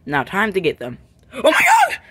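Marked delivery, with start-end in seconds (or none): 1.3-2.0 high, loud startled outburst; startled yell. Acted. Startled at the sudden scare, a high loud cry. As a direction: yelp it as if jumped at.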